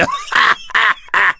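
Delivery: very high demonic cackle; evil laugh. Acted. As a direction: shriek a gleeful fiend's laugh. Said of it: "lança la voix infernale".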